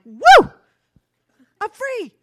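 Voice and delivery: high, loud whoop of release; real shout of freedom; joyful whoop. Real, not acted. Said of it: "That was embarrassing".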